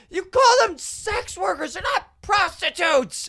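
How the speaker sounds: high, slow, indignant mock screech; outraged woman. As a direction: imitate an offended heckler correcting someone, stretching words. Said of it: "then she's just like".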